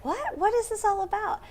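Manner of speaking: high, puzzled double-take question; sudden high-pitched confusion; surprised. Retold or imitated. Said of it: "I'm like".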